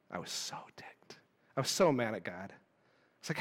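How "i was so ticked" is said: whispered confession. Real, not acted. Confessing anger at God.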